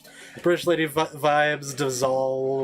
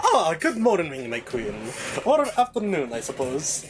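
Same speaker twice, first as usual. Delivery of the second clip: Jarvis. Acted. Servant proudly reporting palace progress to his queen, obsequious. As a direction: grandly report to your queen with swooping pitch. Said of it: "Here's Jarvis!".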